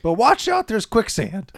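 put-on slow, high old prospector voice. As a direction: channel a crusty old gold miner. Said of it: "that old prospector sketch".

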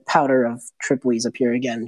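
ordinary talk, nothing marked

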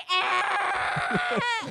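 loud mock yell of dismay; a hammed-up "Ah!" of horror; groaning shout at the returning character; retold anguished cry. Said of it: "And we were like".

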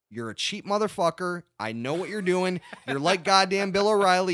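rising rant. Real, not acted. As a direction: build to a loud mocking tirade.

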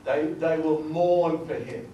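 "mourn" drawn out slowly and loud; stress on MOURN. Real, not acted. Preacher reading the verse to the congregation, stress on "mourn". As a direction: lean hard and long on "mourn". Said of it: "Emphasis on the word mourn".

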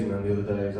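ordinary talk, nothing marked

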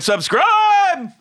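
a yelled, squeaky subscribe; high shouted plug. Acted. Outro call.

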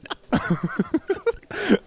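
high Wookiee roar; Chewbacca. Acted. Thanking a patron in Wookiee, Chewbacca roar.

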